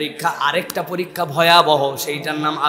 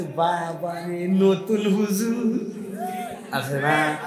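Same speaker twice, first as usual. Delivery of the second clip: mocking taunt; 6 dB quieter, much slower than usual. Friends mockingly tease the newly bearded friend, drawn out. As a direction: stretch each word out to mock him. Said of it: "চিৎকারি করে বলছে".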